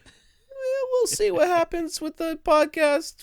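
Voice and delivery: very high, slow falsetto; falsetto joke voice. Pretend. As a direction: squeak it out in a joking falsetto. Joking about missing podcast.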